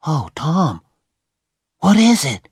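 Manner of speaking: put-on high, rising frightened boy; startled and scared; frightened Huck. Huck asking Tom what he sees, high and frightened.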